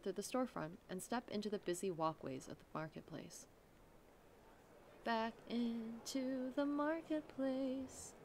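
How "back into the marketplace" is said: very slow, hushed emphasis; slow and dramatic. A narrator's reading. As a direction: draw it out ominously.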